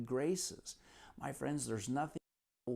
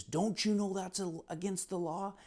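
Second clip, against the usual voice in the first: higher-pitched mock telling-off; retold scolding; 6 semitones higher than usual. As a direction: imitate an officer laying into someone. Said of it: "just lit into him".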